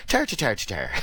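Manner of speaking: lightly voiced Irish "tar, tar" syllables to find the accent; Irish accent warm-up. Shown as a demonstration. Host warming up his Irish accent for his co-host, lightly voiced Irish lilt. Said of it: "I can do the Irish accent".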